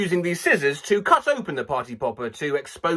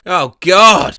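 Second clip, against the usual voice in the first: normal talking voice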